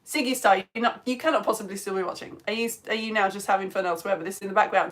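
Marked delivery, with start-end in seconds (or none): none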